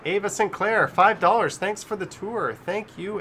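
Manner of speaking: high, ringing announcement; donor shout-out. Real, not acted. Streamer reads out a viewer's donation, high shout-out.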